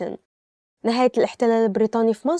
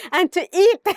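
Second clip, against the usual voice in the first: higher, louder, bubbling with a laugh; excited giggle; 8 semitones higher, 4 dB louder than usual. Real, not acted. Teacher naming her favorite verb, giddy and laughing. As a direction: squeal it playfully.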